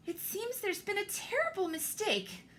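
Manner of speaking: high, worried matron voice; Missus Bulldog. Acted. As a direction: sound alarmed but polite. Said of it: "breaking the silence".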